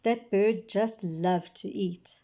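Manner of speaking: ordinary delivery, nothing marked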